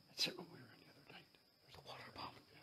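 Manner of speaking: hushed, quick whisper; nervous night vlog whisper. Real, not acted. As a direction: whisper it fast in the dark. Explaining noise in whisper.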